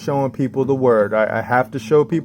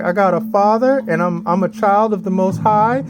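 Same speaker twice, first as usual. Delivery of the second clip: pitched up high, overjoyed at finding a Father; joyful high exclaim; 8 semitones higher than usual. Retold or imitated. Telling viewers how happy he was, high and elated. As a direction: burst out with high delight.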